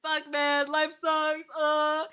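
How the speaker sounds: loud, high self-mocking wail; exaggerated down-in-the-dumps complaint, high and loud; acted-out despair. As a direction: wail it like life is over, hammed up. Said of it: "I was just like".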